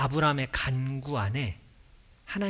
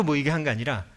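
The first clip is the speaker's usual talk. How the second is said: normal talking voice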